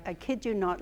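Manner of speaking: usual speaking voice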